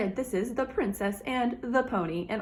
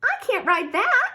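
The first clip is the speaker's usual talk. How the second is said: much higher, louder little princess; a small girl refusing her gift; 13 semitones higher, 6 dB louder than usual. A narrator's reading. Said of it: "said Princess Pinecone".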